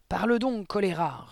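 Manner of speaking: high furious roar. A narrator's reading. Headmistress roaring at Mathilda, loud fury. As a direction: roar it at the girl. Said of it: "rugit mademoiselle Le Gourdin".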